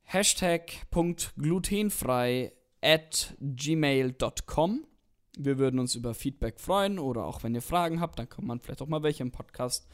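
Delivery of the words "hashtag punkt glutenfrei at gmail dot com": Wort für Wort langsam zum Mitschreiben; langsam diktiert. Real, not acted. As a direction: deutlich und gedehnt vorsagen. Diktiert die E-Mail-Adresse. (Hörern den Namen langsam diktieren)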